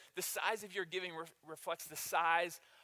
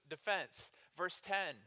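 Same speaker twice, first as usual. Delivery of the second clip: ordinary delivery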